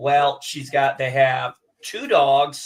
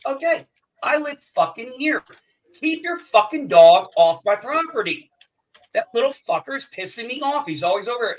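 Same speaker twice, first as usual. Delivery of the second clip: higher, heated tirade at the neighbor; angry rant; 7 semitones higher, 6 dB louder than usual. Retold or imitated. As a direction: let him have it, furious. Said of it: "I was pissed".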